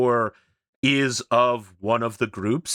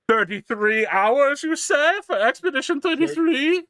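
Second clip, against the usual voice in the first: high squeaky disbelief; mock incredulous; 4 dB louder than usual. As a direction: pretend to mishear it, way up high. Mock mishearing the number.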